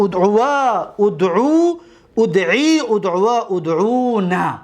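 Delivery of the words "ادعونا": normal talking voice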